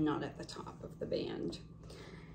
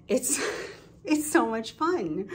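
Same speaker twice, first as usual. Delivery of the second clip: laughing; 5 semitones higher, 5 dB louder, slower than usual. Real, not acted. Laughs while gushing about nails.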